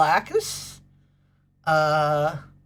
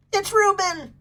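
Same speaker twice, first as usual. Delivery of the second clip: much higher, gleeful call of the name; excited announcement; 10 semitones higher than usual. Real, not acted. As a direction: call out the dog's name with delight. Announcing a viewer's dog.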